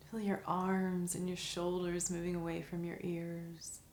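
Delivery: soft, calm meditation voice with long pauses; guided meditation. Acted. Teacher guiding listeners through a five senses meditation, soft and calm.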